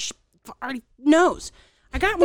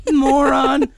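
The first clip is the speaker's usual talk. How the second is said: loud, higher spat insult; mock insult; 4 semitones higher, 10 dB louder than usual. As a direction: call the sister a moron. Retold muttered insult.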